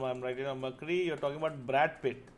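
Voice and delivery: usual speaking voice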